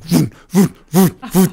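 loud, high mock engine noise; playful vroom vroom of rotors; helicopter vroom. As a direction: imitate the chopper approaching. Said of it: "for the helicopter".

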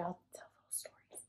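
fast conspiratorial whisper; hushed teaser to viewers; whispered tease. Real, not acted. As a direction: whisper it like a secret promise. Teasing future anniversary stories.

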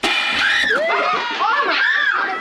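high, loud horrified cry; shocked shout. Acted. Mother sees her son holding a gun. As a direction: gasp it out in shock.

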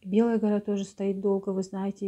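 usual speaking voice

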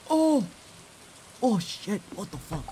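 sharp high startled cry. Real, not acted. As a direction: caught off guard, yelp it. Sudden startled reaction.

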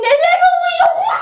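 high-pitched cry, rising at the end; excited disbelief. Real, not acted. Streamer reaching ten thousand viewers, excited squeal. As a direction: let it climb into a squeal.

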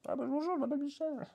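very high silly character voice; talking cat voice. Acted. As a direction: introduce yourself in a squeaky put-on voice. Fait parler le chat rôti. (voix de personnage suraiguë)

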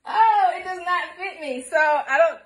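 high, slow, through laughter; wailing while laughing; real laughter breaking the words; laugh-crying. Real, not acted. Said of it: "I gotta first stop laughing".

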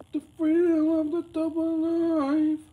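very high, slow put-on trailer voice; dramatic announcer. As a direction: announce the title like a squeaky movie trailer.

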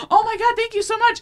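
very high, giddy thanks; retold gushing. Acting out thanking Jennifer Coolidge, high gushing. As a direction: gush it way up high, mock starstruck.

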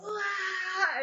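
high, wordless "wah" cry thrown out; retold scream. Screaming back at him during the session. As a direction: let out a raw scream at the piano. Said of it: "we were just screaming at each other".

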